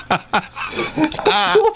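high, slow, laughing apology; helpless laughing. Real, not acted. Cohost apologising for the paint story, helpless laughter. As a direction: giggle through every word. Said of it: "What's going on with you".

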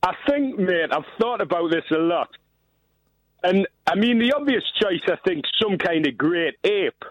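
put-on Geordie accent, matey caller; dodgy Geordie accent. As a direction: lay on a shaky-quality Geordie accent. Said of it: "better than your accent is".

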